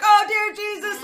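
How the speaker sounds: loud, high panicked outburst; mock horror. Scared viewer reacting to the gas-mask kid, mock horror. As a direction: shriek it in exaggerated fright. Retold terror at the show.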